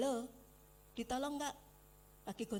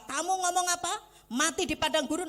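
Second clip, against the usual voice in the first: louder, higher stern pronouncement; God's stern verdict; 6 semitones higher, 9 dB louder than usual. Retold or imitated. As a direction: voice God's judgment. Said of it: "Tuhan bilang apa?".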